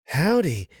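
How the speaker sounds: Englishwoman's clumsy Southern "Howdy", high and drawn out; mock Southern accent "Howdy"; attempted southern drawl. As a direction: stretch "Howdy" like a cowgirl, failing. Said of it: "trying for a southern drawl".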